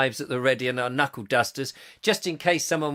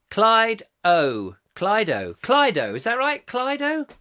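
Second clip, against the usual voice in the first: ordinary delivery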